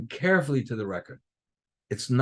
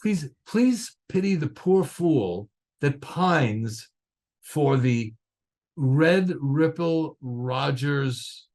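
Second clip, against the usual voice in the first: alliterative note read; much slower than usual. Retold or imitated. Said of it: "it was all this alliteration".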